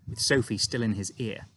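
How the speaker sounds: ordinary delivery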